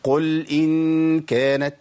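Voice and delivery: "قل إن كانت" chanted slowly, lam in "قل" cleanly vowelless; slow Quranic chant, "قل" closed on a clear l; still lam. Shown as a demonstration. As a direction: recite "قل إن" with a smooth, briefly flowing lam. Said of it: "اسكنها تسكين كويس".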